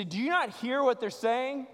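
high-pitched indignant challenge. Acted. Religious elite questioning Jesus about the children, indignant. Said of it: "they were indignant. They're angry".

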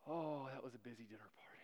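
joking whisper to the audience; whispered aside. Real, not acted. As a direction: breathe it as a wry aside.

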